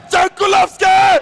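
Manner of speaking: screamed goal call, high and loud; goal shout. Real, not acted. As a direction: erupt as the winner hits the net. Last-second goal, commentator erupts.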